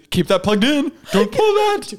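hammed-up alarm; mock frantic orders. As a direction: urgently warn them off the plug, playfully. Mock orders to keep life support.

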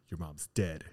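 serious deadpan. Retold or imitated. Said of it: "look at me very seriously".

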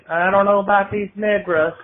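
lightly voiced clueless Southern grandma; grandmother's Southern voice. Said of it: "but she would say".

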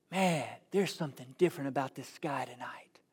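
hushed, low whisper of wonder; awed whisper. Acted. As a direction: whisper it like a shepherd staring at the night sky. Shepherds wondering in the night field.

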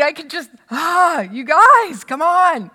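loud, high frustrated plea; Jesus giving up on the disciples, loud and high; exasperated. Retold or imitated. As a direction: throw up your hands at them. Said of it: "Jesus is just like".

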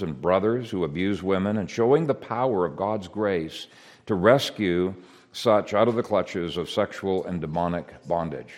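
usual speaking voice

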